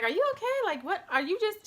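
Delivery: mock astonished concern, pitched well up; imitating baffled relatives. Relatives checking on her in labor, imitated astonishment. Mom and everybody reacting in disbelief.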